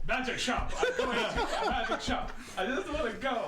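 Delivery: giddy high laughing voice; bubbling over with excitement; excited. Acted. Excited player character.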